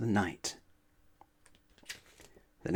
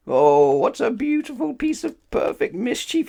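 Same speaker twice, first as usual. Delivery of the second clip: high, loud delighted cry; gleeful cry; 13 semitones higher, 7 dB louder than usual. Acted. Mister Mischief running home after his prank, gleeful cry. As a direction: crow over the prank. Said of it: "he cried".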